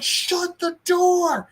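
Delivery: high, slow, drawn-out order; desperate insistence. Retold or imitated. Father insists his wife seal the shelter without him: high, slow, desperate. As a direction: stretch each word out and hold firm.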